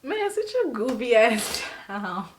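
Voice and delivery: slow, laughing dismissive friend voice; amused friends. Retold or imitated. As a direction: drag it out, laughing at her. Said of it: "They looking like".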